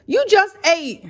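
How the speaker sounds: high, loud, drawn-out protest; exasperated parent calling out; exasperated scolding. Real, not acted. Scolding the grumpy baby mid-talk.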